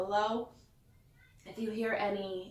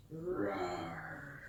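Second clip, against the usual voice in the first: low, growled roar; engine roar; 8 semitones lower, 9 dB quieter than usual. Acted. Bulldozer thunderous sound.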